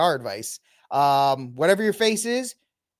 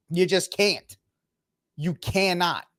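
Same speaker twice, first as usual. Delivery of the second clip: usual speaking voice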